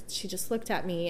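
normal talking voice

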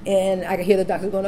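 ordinary talk, nothing marked

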